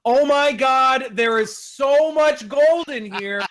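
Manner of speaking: slow, high, drawn-out booming call; loud proclaiming shout. Acted. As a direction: announce it to the whole cave, stretching the words. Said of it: "shout one more time as loud as I possibly".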